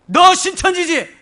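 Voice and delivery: high, loud pouncing accusation; sudden accusing shout. Retold or imitated. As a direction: burst out loudly, catching them out. Blurts accusation at cult visitor on sight.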